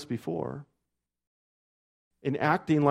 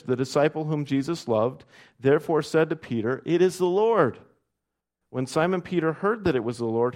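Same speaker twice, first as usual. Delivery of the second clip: louder, measured Bible reading to the congregation; scripture reading; 3 semitones higher, 4 dB louder than usual. A narrator's reading. Pastor reading John twenty-one to the church, measured and ringing. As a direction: read the passage out with lift and weight.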